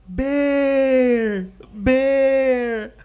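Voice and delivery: high, loud, long-held call; drawn-out high-pitched cry. Acted. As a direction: holler down a cliff, holding the word. Rabbit now calls after fallen bear.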